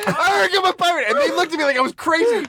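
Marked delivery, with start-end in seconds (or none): 0.1-1.1 mock pirate yell. Comedian repeating his audition blurt, mock pirate yell. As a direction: replay the blurted pirate line up high. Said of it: "you fucking just yelled".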